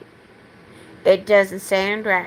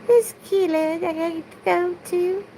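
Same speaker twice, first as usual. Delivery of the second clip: much higher put-on Southern belle, held throughout; 8 semitones higher, 4 dB quieter than usual. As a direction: keep the high-pitched belle voice going through the rant. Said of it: "I still have to speak like a Southern belle".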